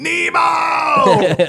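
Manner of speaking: high, loud drawn-out shout of a name; mock yelling. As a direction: holler it out long and high for comedy. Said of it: "would have just started yelling".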